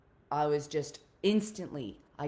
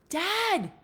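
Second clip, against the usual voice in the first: loud, much higher desperate call; shouting at dad; 7 dB louder than usual. Retold or imitated. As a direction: yell out to her father. Daughter confronts dad, shouting.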